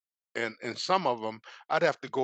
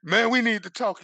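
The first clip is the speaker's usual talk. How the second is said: loud, higher insistent plea; upset demand; 10 semitones higher, 9 dB louder than usual. Retold or imitated. Calling his friend at night, loud and upset. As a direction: press him hard, voice raised.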